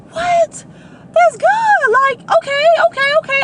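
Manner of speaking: high, loud burst of delight; grandma can't contain her joy; thrilled grandma squealing okay okay; excited grandma. Retold or imitated. Grandma hearing job news.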